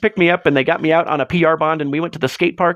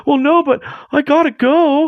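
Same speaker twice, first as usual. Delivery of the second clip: slow whiny higher voice; mocking whiny kid; 8 semitones higher than usual. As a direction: mimic a whiny teen making excuses. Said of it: "And I'm like".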